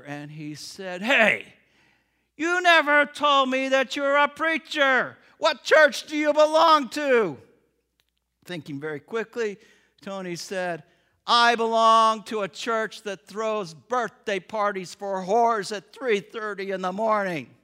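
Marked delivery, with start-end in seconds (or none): 1.0-7.4 louder, higher, slower boom; booming friendly challenge; loud and high across the counter; loud diner man. Acted. 11.2-17.5 bold declaration. Acted. Tony answers boldly.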